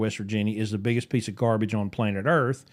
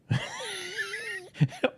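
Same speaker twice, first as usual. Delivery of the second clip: high burst of laughter, much higher; shrieking laugh; 9 dB quieter than usual. Real, not acted. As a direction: let a squealing laugh burst out. Cracks up at his joke.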